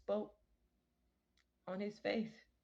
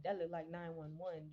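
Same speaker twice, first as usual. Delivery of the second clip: ordinary delivery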